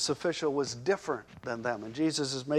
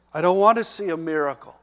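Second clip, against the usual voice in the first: loud, fast, insistent; desperate father; 3 semitones higher, 10 dB louder, faster than usual. Acted. Father insisting to Jesus, loud and fast. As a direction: blurt it out in desperation. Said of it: "essentially he was saying".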